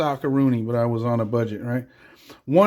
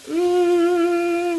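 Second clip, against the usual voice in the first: squealed ooh at the sizzle; a startled high yelp; very high surprised ooh; 14 semitones higher than usual. Real, not acted. Sizzle reaction.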